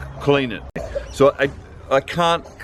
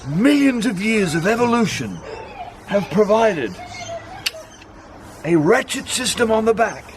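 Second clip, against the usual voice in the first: lightly done wildlife presenter; grand documentary voice, lightly; nature documentary narrator; 7 semitones higher than usual. Pretend.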